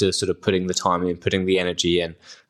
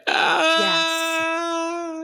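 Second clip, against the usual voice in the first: long, very high drawn-out "aah"; high held cry of being overcome, imitated; imitated emotional "aah". Fan re-enacting her reaction when the theme kicks in, high wail. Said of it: "and you're like".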